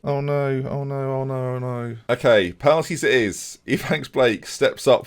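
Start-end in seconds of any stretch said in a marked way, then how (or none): 0.0-2.0 raised, monotone moan fading softer; helpless, sinking wail; dread. Real, not acted. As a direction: repeat it like a droning lament. Dreading a penalty shootout.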